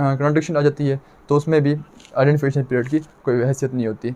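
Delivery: normal talking voice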